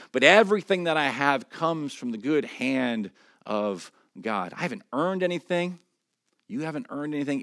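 loud, rising proclamation from the pulpit; preaching at full force. Acted. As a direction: drive each truth home to the congregation. Preacher builds to firm declaration.

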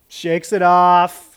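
ordinary talk

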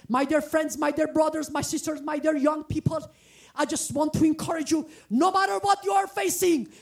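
ordinary talk, nothing marked

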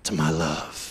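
very low slow-jam lover. Acted. Preacher starts a love letter to the ladies, deep crooner voice. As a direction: drop deep for a romantic spoken intro. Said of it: "read this in the Boyz two Men voice".

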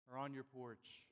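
ordinary delivery

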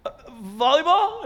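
put-on high, hesitant sleepy-kid guess; guessing son. Son guessing the ball from bed, high and hesitant. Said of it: "He's like".

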